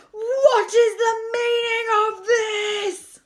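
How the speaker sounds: slow, high, very loud roar; furious bellow. A narrator's reading. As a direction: bellow it out, stretching every word. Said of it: "he managed to bellow".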